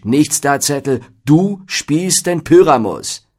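loud, high, forceful veto; emphatic, exasperated order; energetic interruption. A narrator's reading. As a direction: cut him off firmly, punching "du". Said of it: "unterbrach ihn energisch".

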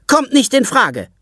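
two mothers insist loudly; mothers calling out. Acted. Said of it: "rufen beide Mütter".

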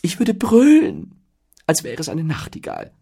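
high voice, loud then softening; hammy show-off going sweet; eager boasting. Acted. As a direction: swell up, then turn dainty. Zettel acts out his dainty roar.

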